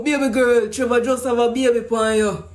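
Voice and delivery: ordinary delivery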